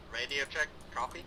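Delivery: ordinary talk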